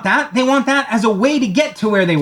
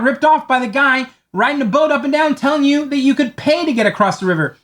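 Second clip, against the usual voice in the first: calling across; 6 semitones higher than usual. Retold or imitated. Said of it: "you yell across to the river".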